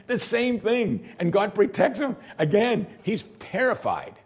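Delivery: can you believe it; incredulous. Real, not acted.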